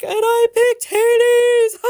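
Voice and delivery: high, loud, slow confession breaking into a laugh. Retold or imitated. Confessing to her partner she chose the cat, laughing. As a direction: blurt the guilty admission as the laugh takes over.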